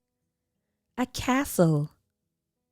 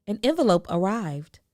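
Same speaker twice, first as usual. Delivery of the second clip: ordinary delivery, nothing marked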